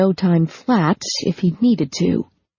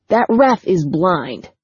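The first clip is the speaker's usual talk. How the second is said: ordinary delivery, nothing marked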